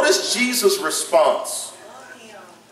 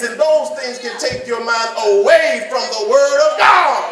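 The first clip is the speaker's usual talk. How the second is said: preaching at full force; 5 semitones higher than usual. Acted. Preacher shouting to worried listeners about money troubles. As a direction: drive it home to the congregation.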